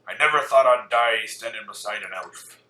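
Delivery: loud gruff Scottish dwarf; Scottish accent. Shown as a demonstration. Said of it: "a nice, like, Scottish accent".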